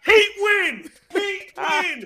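loud fan yell, voice high; cheering shout. Real, not acted. Fan shouting to his podcast mates about his team, loud cheering yell. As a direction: yell it out for the Heat like a fan in the stands.

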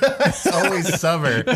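drawn out through giggles; laughing. Real, not acted. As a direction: player laughing along at the table. Table laughing at desert joke.

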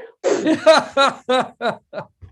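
hearty burst of laughter; loud laugh. Real, not acted. Big laugh after joke.

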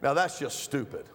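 ordinary delivery

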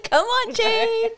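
coaxing through laughter; laughing. Real, not acted. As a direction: egg her on while laughing. Coaxing friend to do accent.

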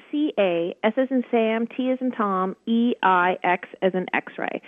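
letter by letter, C A S as in Sam; spelling it out. Shown as a demonstration. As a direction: spell [name] clearly, slowing on E I X. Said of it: "my website is my last name, [name]".

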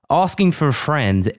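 a mangled attempt at an Australian accent; hammed-up Aussie voice, badly faked. Podcast host reading a listener's question in a failed Aussie accent. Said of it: "I know what accent that was meant to be".

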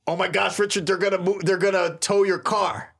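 imitating a flustered phone call; frantic friend. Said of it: "like, frantic".

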